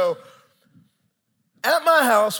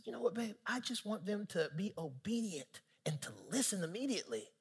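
much softer, lowered volume; soft confiding; 13 dB quieter than usual. Retold or imitated. Husband telling his wife about the kids, soft confiding. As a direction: say it gently, as a private aside.

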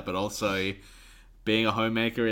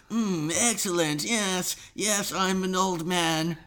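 put-on much higher, slow old-man voice; Mister Burns impression; 9 semitones higher, slower than usual. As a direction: croak it like a deliberately hammy old Burns. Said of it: "Now I'm gonna be Mister Burns".

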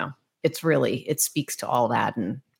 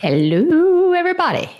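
much higher and louder, a bright sung-out greeting; big excited hello; 7 dB louder than usual. Acted. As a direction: throw the hello up high to the whole room. Guest's big hello on intro.

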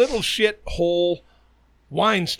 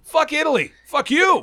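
much higher, indignant cursing; comic angry outburst; 10 semitones higher, 3 dB louder than usual. Real, not acted. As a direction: fire off exasperated insults. Ranting at the Italian and co-host.

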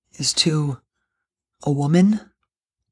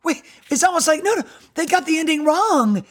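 disbelief; 10 semitones higher than usual. Retold or imitated. Imagined reader protesting the ending.

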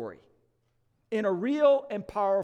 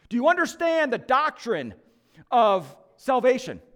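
louder and higher, a pressing interrogation; stern angel; 5 semitones higher, 7 dB louder than usual. Retold or imitated. Supervising angel quizzing the thief on doctrine, loud and pressing. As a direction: grill him like an official.

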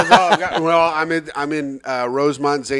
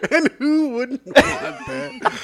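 laughing echo; 11 semitones higher, much slower than usual. Real, not acted. Mock echo through laughter.

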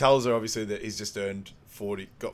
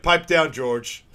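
loud, higher mock scolding; acted rebuke; 5 semitones higher, 10 dB louder, much slower than usual. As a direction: cut him off with a loud put-down. Acted put-down in retold scene.

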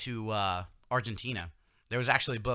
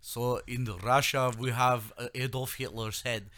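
slow, careful Russian accent attempt. Host retrying the accent on request, in a slow Russian accent. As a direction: try a lightly done Russian voice. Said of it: "Let me see if I can do a Russian".

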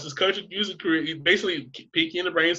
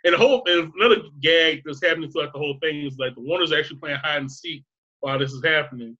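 usual speaking voice

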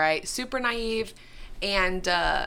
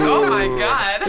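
slow, loud, laughing gasp of shock; laughing mock shock; 9 dB louder, slower than usual. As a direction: play the boy's stunned reaction. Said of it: "he was like".

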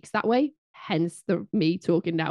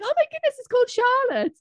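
much higher, amazed mock gasp; faster than usual. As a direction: act amazed at the name. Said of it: "they were like".

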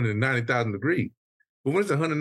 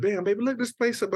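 retold excitement; 11 semitones higher than usual. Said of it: "I said".

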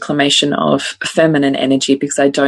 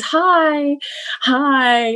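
put-on little-girl greeting, pitched higher; high toddler hi; 10 semitones higher than usual. Mother imitating daughter at book launch mic, high chirpy hi. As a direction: chirp hi like a three-year-old. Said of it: "And so she continues".

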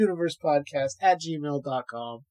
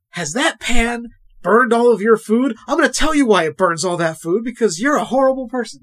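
infomercial guy; 6 semitones higher, 8 dB louder than usual. Pretend. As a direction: sell it like a late-night TV ad host. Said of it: "I'm going into my, uh, infomercial guy here".

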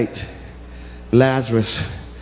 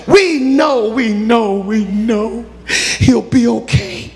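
desperate plea; 7 semitones higher, slower than usual. Acted. Messenger pleading with Jesus, rising to a high slow cry. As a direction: beg the Master to come before it's too late.

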